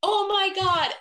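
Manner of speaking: high, loud, drawn-out mock outcry; astonished room. Whole room recognizing the wedding site's owner, astonished. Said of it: "the whole room at once was like".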